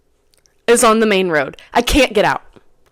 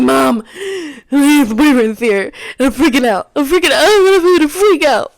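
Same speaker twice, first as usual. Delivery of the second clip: frantic plea to mom, rising higher; retold tearful freak-out; 6 semitones higher, 3 dB louder than usual. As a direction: act out panic that builds with each phrase. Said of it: "I'm crying".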